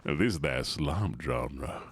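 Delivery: drawn-out deep mock voice. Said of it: "talk like this".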